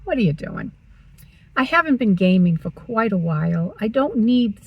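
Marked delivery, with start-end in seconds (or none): 0.0-0.7 scolding herself. Retold or imitated. Vlogger telling herself off over desk clutter, mildly scolding. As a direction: chide yourself, stretching the name then dropping. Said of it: "And I'm like".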